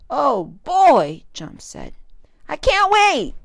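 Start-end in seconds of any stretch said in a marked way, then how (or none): 0.1-1.2 loud, eager outburst; can hardly contain the joy; excited. Acted. Child can't wait for Valentine's. 2.5-3.3 high, loud, eager cry; excited. Acted. Child can't wait for Valentine's.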